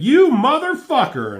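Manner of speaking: high, loud furious yell; furious outburst. Acted. Deathbird insulted by Bishop, high furious shout. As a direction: spit it at him in outrage.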